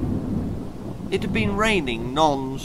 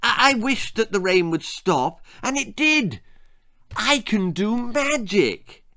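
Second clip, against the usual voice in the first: put-on thrilled girl; 8 semitones higher than usual. Girl thinks she worked magic.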